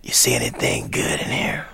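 low whispered rasp; imitated gruff jazzman, hoarse and low. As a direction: drop to a gruff, voiceless whisper. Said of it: "He says".

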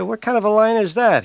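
high-pitched imitation of a baffled friend; friend's baffled objection. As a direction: mimic a friend querying the line, pitch jumping up. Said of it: "he said".

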